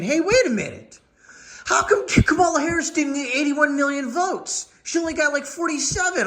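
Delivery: high, whiny mock outrage; hammed-up high protest voice; mock whiny complainer. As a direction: parody a tearful conspiracy claim. Mocking complaining leftists.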